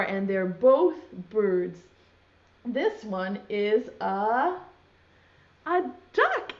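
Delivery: ordinary talk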